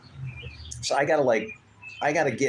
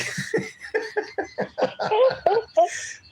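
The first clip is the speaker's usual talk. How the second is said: laughing crying. Retold or imitated. Retelling caller's tears.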